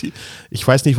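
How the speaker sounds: ordinary talk, nothing marked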